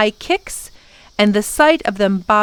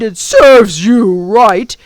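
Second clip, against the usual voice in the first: loud, drawn-out creature taunt; gloating gaboon; 8 dB louder, much slower than usual. A narrator's reading. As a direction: rub it in, loud and slow. Gaboon mocks crying girl.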